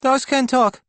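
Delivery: put-on tiny squeaky boy, very high and loud; high-pitched child. A narrator's reading. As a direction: pipe up in a piercing little-kid squeak. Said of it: "a high-pitched voice replies".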